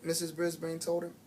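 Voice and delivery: plain reading voice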